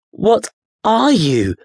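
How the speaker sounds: high, slow and bewildered; a boy staring at a strange creature. Acted. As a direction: ask it in utter bewilderment. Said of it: "Daniel asked, bewildered".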